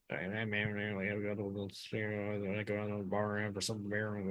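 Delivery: mumbled, swallowed words like Boomhauer; Boomhauer mumble. Retold or imitated. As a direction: slur it all together, barely enunciated. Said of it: "like Boomhauer from King of the Hill".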